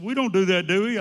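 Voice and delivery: high, loud disbelief, imitating the boss; retold incredulous protest. Boss hearing he cancels orders every year, loudly incredulous. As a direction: act out the boss's startled denial.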